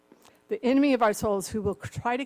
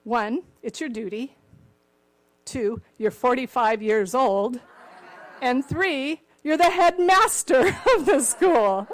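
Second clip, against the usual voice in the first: louder, deliberate with a laugh at the end; father listing reasons, louder and building; father's punchline; 7 dB louder than usual. Retold or imitated. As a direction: build to the reveal then laugh. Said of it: "he goes".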